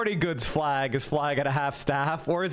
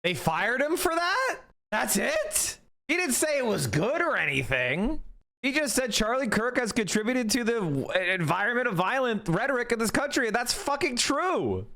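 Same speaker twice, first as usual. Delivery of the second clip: incredulous outrage; 6 semitones higher than usual. Real, not acted. Streamer reacts to firing news.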